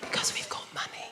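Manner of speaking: hushed whisper, as a posh low talker. Pretend. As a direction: breathe it like a secret. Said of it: "low talkers".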